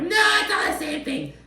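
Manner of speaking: very high-pitched mock protest; mocking objector. Host imitating people who disagree, in a high mocking voice. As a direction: parody a nitpicker way up high.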